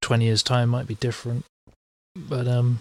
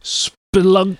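higher, stretched comic effect word; mock Batman effect; 6 semitones higher, 3 dB louder than usual. Podcaster riffing on Batman fight words, mock sound effect. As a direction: say it like a fight caption. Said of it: "Bring back, uh, the old Batman".

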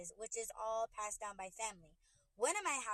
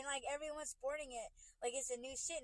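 normal talking voice

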